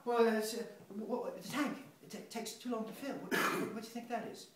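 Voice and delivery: high, stammering nervous voice; nervous rambling. Acted. A nervous man stammers about the toilet tank, high and jittery.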